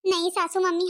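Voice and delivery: ordinary talk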